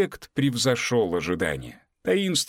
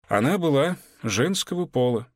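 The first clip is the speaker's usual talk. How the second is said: plain reading voice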